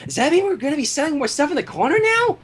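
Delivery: chirpy excited filly; Pinkie Pie voice. A narrator's reading. Narrator voicing Pinkie to the Cakes, bubbly and high. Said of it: "asked Pinkie Pie".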